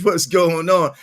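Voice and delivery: usual speaking voice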